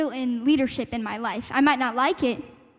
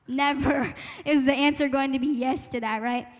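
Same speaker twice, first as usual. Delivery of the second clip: ordinary delivery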